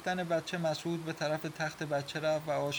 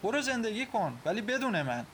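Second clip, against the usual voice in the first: high-pitched, hurt dismissal; bitter rejection; 8 semitones higher, 3 dB louder than usual. Acted. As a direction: send him away bitterly. Acted quarrel, wife rejects husband. (با دلخوری و تندی)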